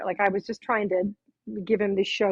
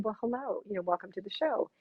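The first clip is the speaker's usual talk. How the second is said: gentle welcome; 7 dB quieter than usual. Retold or imitated. Greeting disfigured guest gently.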